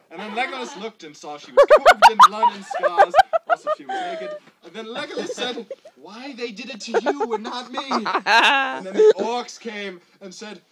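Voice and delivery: barely holding it together, giggling; laughing while reading. Real, not acted. Reader cracks up at the story.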